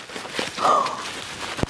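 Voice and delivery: soft voiceless gasp; amazed gasp. Real, not acted. Unwrapping a beautiful pot, amazed gasp. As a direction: gasp in overwhelmed wonder.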